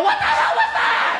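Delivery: very high, loud squeal of disbelief; a squeaky, loud shocked cry; shrieking shock. Retold or imitated. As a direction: shriek it out, rattled. Shocked after flipping upside down.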